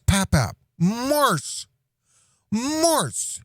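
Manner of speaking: put-on high, earnest little-girl voice; serious toddler voice. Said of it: "in, like, a real serious face".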